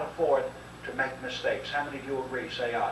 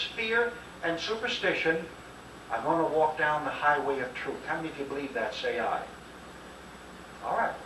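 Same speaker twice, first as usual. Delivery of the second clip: normal talking voice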